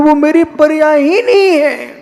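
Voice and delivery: pleading through tears that it isn't his; tearful insistence. Real, not acted. Preacher to his listeners, choked with tears. उनमें ही हम रो रहे हैं.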